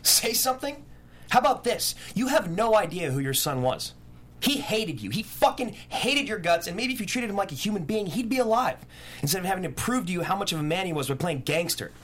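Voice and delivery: high, raw, rising fury; furious outburst. Acted. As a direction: tear into him, voice cracking high. Curran lashes out at the father.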